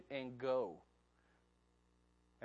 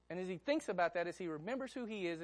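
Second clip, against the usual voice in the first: ordinary talk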